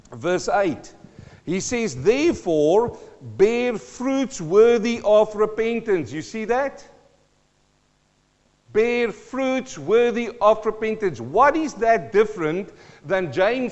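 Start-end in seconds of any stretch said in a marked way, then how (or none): 2.0-6.1 high, loud, slow proclaiming; preaching at full force. Acted. Preacher proclaiming John's command to the crowd, loud and slow. 8.7-11.2 high, loud, slow proclaiming; preaching at full force. Acted. Preacher proclaiming John's command to the crowd, loud and slow. Repeats verse, emphatic.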